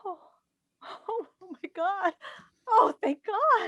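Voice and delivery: high, loud cries of relief; overjoyed relief. Acted. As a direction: burst out in joyful relief. Son wakes, mother overwhelmed.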